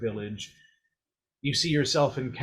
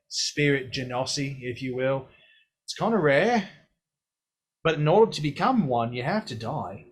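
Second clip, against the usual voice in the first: put-on blunt, teasing old figure explaining death; Mor'du. Mor'du explaining to the hero that he died, teasing. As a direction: lean in and lecture with cheeky bluntness.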